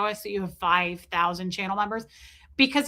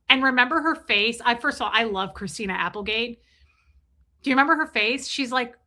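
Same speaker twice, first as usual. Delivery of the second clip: normal talking voice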